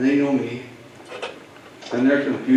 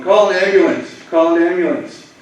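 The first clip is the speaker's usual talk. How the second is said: urgent, higher, louder call for an ambulance; yelling to bystanders for an ambulance; retold yell for help; 6 semitones higher, 6 dB louder than usual. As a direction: shout it up out of the ditch. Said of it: "I'm yelling".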